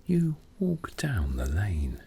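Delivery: plain reading voice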